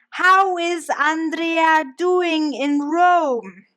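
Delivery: slow, loud, high near-shout from grandma; slow, loud half-shout of a grandma; almost shouting. A narrator's reading. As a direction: nonna repeating herself very slowly and loudly so the foreigner understands. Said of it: "now much louder, almost shouting".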